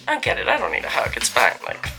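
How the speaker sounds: ordinary talk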